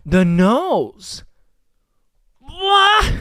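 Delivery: high, loud, puzzled "The nose? What?"; mock baffled surprise. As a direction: imitate her blurting out in disbelief. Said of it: "and then she was like".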